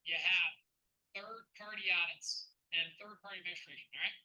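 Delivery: hammed-up Canadian accent with punched openings; put-on Canadian accent, driving the point home. Shown as a demonstration. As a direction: lay on a folksy Canadian accent, emphatically. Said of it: "I'm gonna put my Canadian back hat back on".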